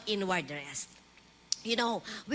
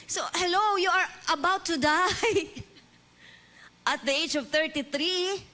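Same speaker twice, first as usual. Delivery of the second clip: rising dramatic appeal; 5 semitones higher than usual. Acted. Preacher dramatizes Jesus facing death.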